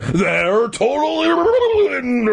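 slow, very high, stretched-out wail; hammed-up roar, dragging the last word out. A narrator's reading. Mister McCall to the neighbours about his melons, roaring theatrically. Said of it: "he roared".

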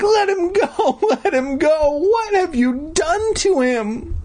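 put-on very high panicked girl's screech; shrieking. A narrator's reading. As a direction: screech it, slowing into a horrified wail. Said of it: "I heard Mindy shriek".

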